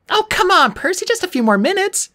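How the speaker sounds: put-on high, pleading girl voice; whiny girl voice. A narrator's reading. Annabeth whines for more time.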